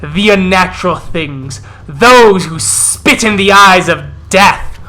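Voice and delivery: forceful rallying speech, loud bursts on key words; rally the pack as a fierce elder; commanding war-leader with weighty pauses; growling wolf elder. Acted. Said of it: "As he growls and snaps".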